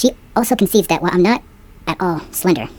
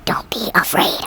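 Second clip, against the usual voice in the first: breathy whispered don't be afraid; 3 dB quieter, slower than usual. Shown as a demonstration. Stranger behind her on the street, whispering. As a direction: hush it like a secret behind her. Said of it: "I gotta whisper".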